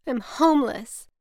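a touch of despair; distressed. A narrator's reading. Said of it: "She could barely talk".

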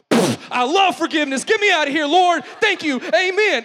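high, loud, gleeful thanks to God; mock-ecstatic praise; giddy relief. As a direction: celebrate like you got away with it. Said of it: "I'm like".

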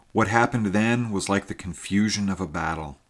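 ordinary delivery

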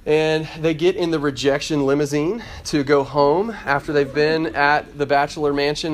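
ordinary talk, nothing marked

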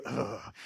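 low, soft groan of distaste; a quiet grossed-out ugh; disgusted ugh. Pretend. Preacher reacts to Jezebel's name with a low ugh.

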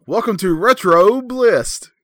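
sing-out the greeting slowly and loudly; signature show intro. Shown as a demonstration. Host models the line for Anthony to replicate, signature intro. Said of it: "Do it again, [name]".

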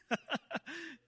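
high burst of laughter; real laugh. Real, not acted. Preacher laughing at the surf story. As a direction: laugh at your own joke.

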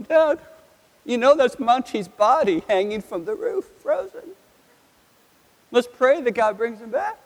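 put-on high, eager kid's voice; a child's high, wide-eyed voice; excited little boy. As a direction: play the small son pointing at the icicle. Son Brandon quoted as a child.

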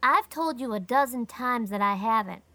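put-on hurt, put-upon boy; injured air. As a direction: sound wronged and sulky. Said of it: "said Davy with an injured air".

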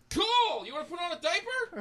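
put-on a boy's penis greeting him cheerfully; voicing a penis. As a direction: play the penis as a chirpy buddy. Penis voice again.

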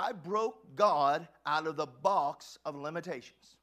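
loud, slow and emphatic; bold declaration. Real, not acted. Preacher declaring to the congregation, loud and bold. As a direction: proclaim it to the church.

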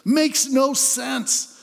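a prophet's anguished why, held up high; crying out to God. Retold or imitated. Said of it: "he's crying out".

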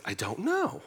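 high-pitched imitation of a bewildered wife; mock cluelessness, lifted high and rising. Wife answering her husband, imitated as high-pitched bewilderment. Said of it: "And she was like".

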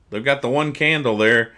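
ordinary talk, nothing marked

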